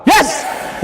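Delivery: high-pitched demonstration yell; sharp, high rallying shout. Shown as a demonstration. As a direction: belt it out to lead the group.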